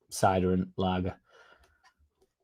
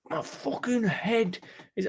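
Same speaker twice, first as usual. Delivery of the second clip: groggy mate voice; 11 semitones higher, 7 dB quieter, slower than usual. Retold or imitated. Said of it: "it'd be like".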